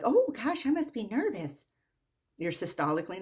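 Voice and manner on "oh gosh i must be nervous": high, sing-song mock worry; exaggerated high-pitched imitation of nervous patients; mocking patients' excuse. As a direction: flutter it high like a fretting patient. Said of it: "and they're like".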